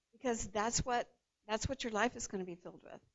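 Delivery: ordinary talk, nothing marked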